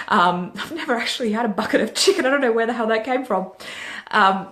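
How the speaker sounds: can't stop laughing at her own joke; real giggles breaking into the talk; laughing at herself. Real, not acted. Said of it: "I'm cracking myself up now".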